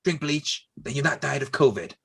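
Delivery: high-pitched mock presidential advice; joking politician impression with false starts; Donald Trump. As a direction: stumble through it like a muddled president. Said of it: "Did you watch Donald Trump for the last four years?".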